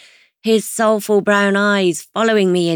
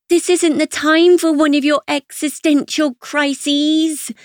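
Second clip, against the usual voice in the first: yelling over music; 8 semitones higher than usual. Acted. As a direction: shout above the party noise. Chloe yells above the music.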